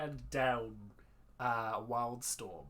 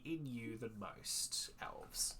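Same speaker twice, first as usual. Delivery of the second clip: hushed secret whisper; 8 dB quieter, much slower than usual. Acted. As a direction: lean in and whisper it.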